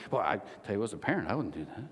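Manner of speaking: put-on low, soft judging old-timer; hushed, low put-on parent voice; mock older parent. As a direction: mutter it like a disapproving older parent. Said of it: "to all you older parents".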